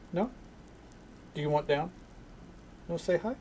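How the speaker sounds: gentle sing-song for a pet; talking to the dog. Real, not acted. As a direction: coax the dog to come say hello. Talking sweetly to his dog.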